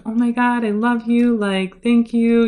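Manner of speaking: high, gushing, overwhelmed fan; breathless, starstruck thanks. Retold or imitated. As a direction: gush at him, barely holding it together. Starstruck fan meeting her idol.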